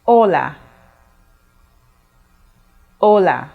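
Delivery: hola spoken clearly with a silent h, falling; clear Spanish hola. Shown as a demonstration. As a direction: model hola for the class, h silent. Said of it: "In Spanish, you say".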